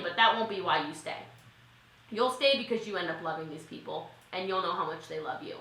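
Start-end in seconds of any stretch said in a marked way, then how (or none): none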